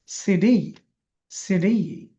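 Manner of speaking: CD with a long, stressed ডি; stress on last letter. Shown as a demonstration. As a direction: push the final ডি in CD. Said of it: "জোরে বলতে হবে".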